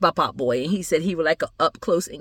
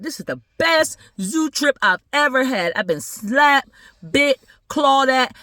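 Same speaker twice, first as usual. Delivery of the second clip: put-on high, emphatic kid's boast; excited boy; 6 semitones higher, 3 dB louder, slower than usual. Boy tells family about best trip, excited. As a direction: list it proudly, punching each word.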